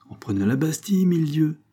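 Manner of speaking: defiant resolve. A narrator's reading. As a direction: answer boldly, ending on a swear. Farmer's defiant oath to the principal. (avec défi et détermination)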